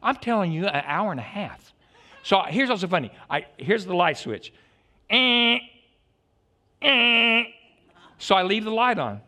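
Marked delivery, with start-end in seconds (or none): 5.1-7.4 loud high croaking eh; frog croak imitation. Preacher imitates the frog croaking at night, loud croak.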